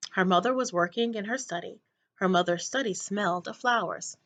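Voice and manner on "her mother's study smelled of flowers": usual speaking voice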